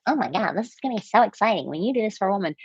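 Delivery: fast, gushing, hammed-up excitement; wrong over-excited tone. Shown as a demonstration. Coach showing viewers the wrong compliment tone, fast gushing. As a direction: rattle it off like an overeager fan. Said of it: "And I was talking like this".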